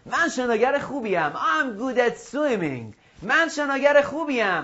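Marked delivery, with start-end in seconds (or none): 1.3-3.0 very slow, higher classroom model; slow drill model. Shown as a demonstration. Teacher gives English after the Persian, slow drill. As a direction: draw it out so pupils can repeat.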